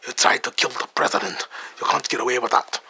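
a light Scottish accent, imitating the hero; gently mock Scottish delivery of the threat; hint of a Scottish brogue. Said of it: "He's Scottish".

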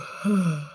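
long weary sigh; tired sigh. Real, not acted. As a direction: sigh out the exhaustion. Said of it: "I'm so tired".

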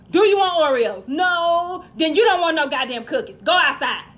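loud, exasperated parental rant; comic angry parent. Acted. Dad yelling at his whining kid over cookies, exasperated shout.

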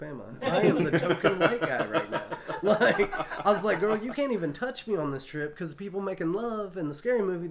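words pushed out through loud laughter; can barely get it out for laughing; laughing while telling. Retold or imitated. Telling friends the punchline of his own story, laughing hard.

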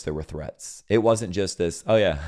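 normal talking voice

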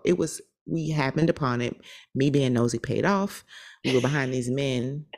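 normal talking voice

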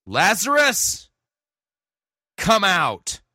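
high, loud, drawn-out call; loud cry. A narrator's reading. Jesus calling Lazarus out of the tomb, loud cry. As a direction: call him out of the tomb at full voice. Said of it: "he cried out with a loud voice".

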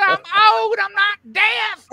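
loud, high-pitched retort, drawn out at the end; indignant shout. Retold or imitated. Old woman correcting the officer, loudly indignant. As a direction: holler it back in mock outrage.

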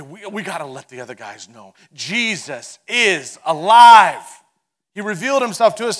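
loud, urgent burst of joyful news; excited announcement. Acted. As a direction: shout it out like you can't wait to tell them. Disciples rush to tell news.

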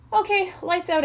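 high, called-out announcement; brisk counselor announcement; announcing. A narrator's reading. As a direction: call it out to the whole cabin. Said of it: "announced Chip".

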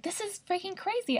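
high, exasperated disbelief. Retold or imitated. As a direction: throw it up in disbelief. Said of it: "I was like".

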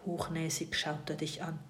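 plain reading voice

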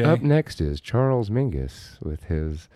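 slow, smooth late-night jazz announcer; unhurried jazz radio voice. Pretend. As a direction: introduce a record as a slow-talking jazz DJ. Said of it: "Will you do a jazz D J".

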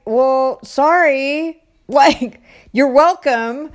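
high, loud mock apology; indignant sarcasm. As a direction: retort as if to someone ungrateful. Retells sarcastic retort to ungrateful girl.

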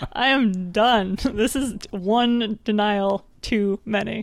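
slow, deliberate, half-laughing; slow fed-up declaration. Retold or imitated. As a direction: spell out each word like a fed-up kid. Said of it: "I'm like".

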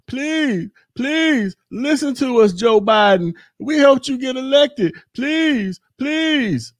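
high, slow whiny pleading, mocking the writers; mock begging. Vlogger mocking BLM's letter to Joe Biden as high, slow begging. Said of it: "They was begging Joe Biden".

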